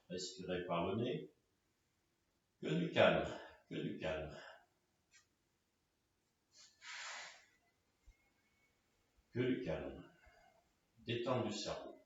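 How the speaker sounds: low, very soft calming voice; hushed meditation cues; guided relaxation. Real, not acted. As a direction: soothe them into stillness, with long pauses. Guides breathing and calm.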